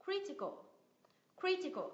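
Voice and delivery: CRI in "Critical" pitched high, then falling away; "critical" as CRI-ti-cal, first syllable stressed. Shown as a demonstration. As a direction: hit CRI in "critical", let the rest drop. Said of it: "nhấn âm tiết từ ba từ cuối trở lên".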